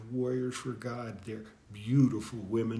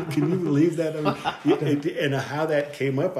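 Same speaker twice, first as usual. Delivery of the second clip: laughing in disbelief, louder and higher; 4 semitones higher, 6 dB louder than usual. Real, not acted. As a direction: share the amazement with a laugh. Marvelling at miles traveled.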